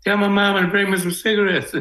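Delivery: high-pitched call out to a passer-by, re-enacted; retold yell. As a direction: imitate yelling out to someone on the street. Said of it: "yell out".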